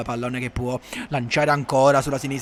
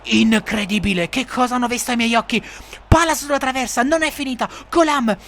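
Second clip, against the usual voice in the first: breathless italian goal commentary; 11 semitones higher than usual. Acted. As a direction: build then gasp at the miss. Missed chance.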